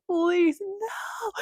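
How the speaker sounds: high, desperate please then no; pleading. Retold or imitated. Pleading with her spirit team, high and desperate. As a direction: beg them to let her be.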